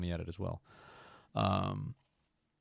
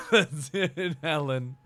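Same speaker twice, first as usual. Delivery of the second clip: laughing; 4 semitones higher, 6 dB louder, much slower than usual. Real, not acted. Laughing at new nickname.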